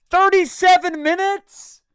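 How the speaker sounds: high, loud, slow disbelief; shocked disbelief. Real, not acted. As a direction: stretch it out, aghast. Shocked at late notice.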